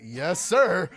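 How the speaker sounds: loud mock-heroic rally; hammed-up battle cry. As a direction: call the team to arms, playfully. Acts out rallying text reply.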